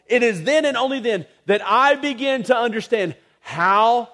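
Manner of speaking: high, loud preacher's cadence, slowing on sacrificed; preaching at full force. Acted. Pastor pressing the sacrifice on the congregation, full-force preaching. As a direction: preach it out with rising fervour.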